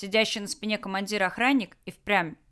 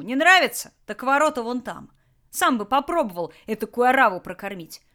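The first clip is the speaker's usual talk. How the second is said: a hint of a menacing thug, louder; lazy threatening boss voice; gruff bandit boss; 3 semitones higher, 7 dB louder than usual. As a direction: bandit leader menacing his prisoners. Tolyan justifies himself.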